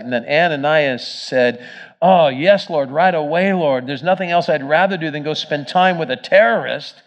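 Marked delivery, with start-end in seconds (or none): 2.0-7.0 mock cheerful, loud and high; sarcastic eagerness. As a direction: fake keenness dripping sarcasm.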